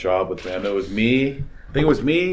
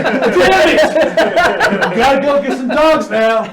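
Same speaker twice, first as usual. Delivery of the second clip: much higher, louder laughing outburst; exasperated mock shout; 7 dB louder, slower than usual. Mover groaning about chasing the dogs, high laughing shout. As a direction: blurt it out, fed up but laughing.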